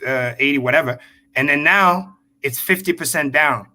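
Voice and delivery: ordinary delivery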